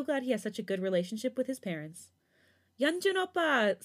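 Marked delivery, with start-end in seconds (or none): 2.8-3.8 high, slow, drawn-out squeal; squealing child. A narrator's reading. Little girl greeting her uncle, high squeal. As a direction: squeal his name like an excited kid. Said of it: "Sujin squeals".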